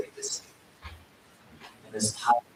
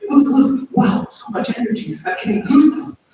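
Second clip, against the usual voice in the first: high, loud mock thrill; hammed-up amazement; 8 dB louder than usual. Imitating Guruji putting on a show for the new devotee, hammed-up excitement. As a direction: overplay the goosebumps for the visitor. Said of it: "he made himself have goosebumps".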